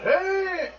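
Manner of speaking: high, drawn-out hey; calling out. Acted. Unseen newcomer calling out as footsteps approach, high drawn-out call. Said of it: "They're calling out".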